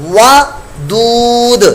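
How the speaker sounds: ودود drawn out very slowly with a on the waw; slow correct wadud. Shown as a demonstration. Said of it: "ودود نہیں کرنا".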